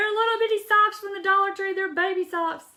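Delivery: high, loud delight over tiny socks; gushing. Real, not acted. Crafter showing viewers tiny baby socks, gushing high. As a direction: squeal over the little socks.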